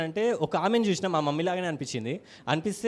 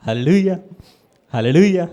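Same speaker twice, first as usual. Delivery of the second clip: loud, ringing hallelujah; preacher's hallelujah; 9 dB louder than usual. Real, not acted. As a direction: rouse the congregation with a louder hallelujah. Preacher's exclamation. (బోధకుడి గట్టి హల్లెలూయా)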